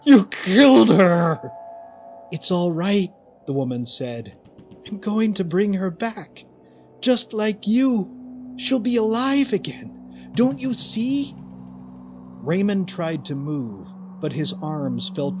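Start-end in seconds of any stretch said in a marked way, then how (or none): 0.0-1.5 even louder high scream. A narrator's reading. Raymond accusing the woman a second time, louder scream. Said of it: "Raymond screamed". 2.3-11.3 calm him like a madwoman; eerie reassurance. A narrator's reading. The woman soothing Raymond over the body, eerie reassurance.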